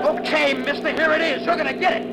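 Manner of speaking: high, strained taunt; fierce threat. Acted. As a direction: threaten the other driver at the climax. Driver taunts pursuer at climax.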